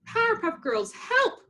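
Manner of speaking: very high desperate call; cry for help. A narrator's reading. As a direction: call out for rescue. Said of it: "the girls heard a cry".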